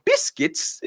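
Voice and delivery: high-pitched, puzzled mock schoolboy; imitating a bewildered British kid; confused British schoolboy. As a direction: squeak it like a baffled boy. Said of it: "middle school British boys".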